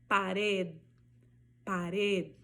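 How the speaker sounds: pared with a barely voiced final D; pared, let the D fade out; silent final D. Shown as a demonstration. Teacher showing mouth shape for pared. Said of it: "Olha aqui como ... articulação da minha boca".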